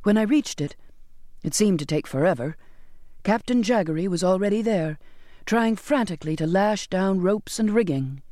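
usual speaking voice

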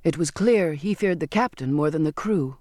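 usual speaking voice

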